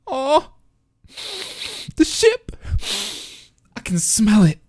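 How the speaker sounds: very high, swooning over the ship; swooning squeal. Real, not acted. A fan squeals over the two characters hugging. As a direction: squeal like a fangirl.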